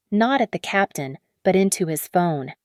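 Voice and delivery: usual speaking voice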